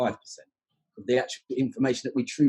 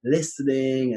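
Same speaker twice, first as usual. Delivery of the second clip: slightly raised, announcing classroom voice; lightly acted teacher's announcement; teacher announcing a task; 3 semitones higher, much slower than usual. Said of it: "you know, you say".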